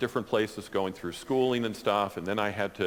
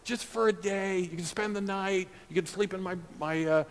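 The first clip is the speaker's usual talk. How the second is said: higher, pleading friend voice; begging friend; 9 semitones higher than usual. Retold or imitated. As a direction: coax him to come, pleading. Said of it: "he was, like, begging me".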